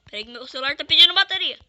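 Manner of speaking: fast loud aside; loud and rushed. Real, not acted. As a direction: blurt it out quickly.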